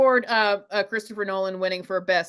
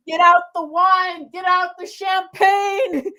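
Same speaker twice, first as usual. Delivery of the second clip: high, drawn-out celebratory cry; jubilant calling out; 7 semitones higher, 3 dB louder, slower than usual. Real, not acted. Co-host calling for champagne after the win, jubilant cry. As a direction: stretch it out like a victory toast.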